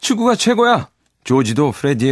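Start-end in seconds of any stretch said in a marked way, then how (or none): 0.0-0.9 high, loud cheer; boast shout. Acted. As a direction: shout your side of the game. George shouts back in game.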